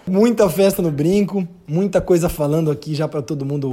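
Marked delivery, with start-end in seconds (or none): none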